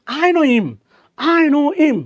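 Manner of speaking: I know him with him said as im, no h; h dropped in him. Shown as a demonstration. Teacher drills the class on a dropped-h him. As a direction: run know him together as noi-m. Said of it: "উচ্চারণ করেন, ভাল লাগবে".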